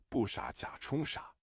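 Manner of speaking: ordinary delivery, nothing marked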